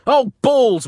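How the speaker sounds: high, loud pained exclamation; acted exasperated cry; wincing dismay. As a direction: wince out loud. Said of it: "winced the Vatican vicar".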